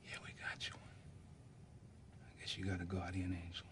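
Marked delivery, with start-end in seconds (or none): 0.1-1.0 soft unvoiced whisper at a bedside; hushed whisper so the boy can rest. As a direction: breathe the words to a sick child. Father at dying son's bed.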